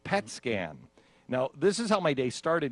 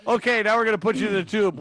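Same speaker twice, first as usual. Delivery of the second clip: high, loud, quick announcement; hammed-up staff voice; imitating medical staff; 10 semitones higher, 10 dB louder, faster than usual. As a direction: play the brisk scan staff over-loudly. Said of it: "and then they're like".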